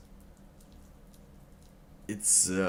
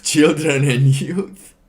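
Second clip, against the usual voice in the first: reading aloud, breaking into loud laughter; laughing through reading; 7 semitones higher, 12 dB louder, slower than usual. Real, not acted. Streamer reading the statement aloud, cracking up into loud laughter.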